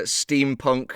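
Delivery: ordinary talk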